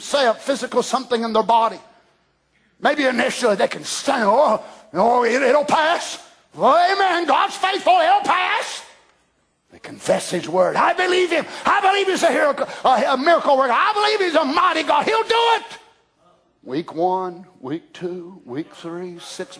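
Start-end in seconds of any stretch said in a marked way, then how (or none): 4.2-8.8 high, breezy mock believer shrugging it off; upbeat believer. Preacher mimics a cheerful new sufferer, high and breezy. 10.8-15.6 high, fast mock confession of faith; eager believer. Preacher mimics a believer, high and fast.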